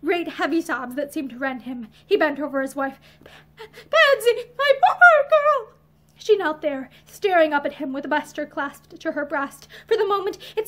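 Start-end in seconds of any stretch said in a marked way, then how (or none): 3.6-5.7 high, loud, slow sobbing cry; tearful wail, drawn out. A narrator's reading. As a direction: sob the words out, heartbroken. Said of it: "Matherson was sobbing".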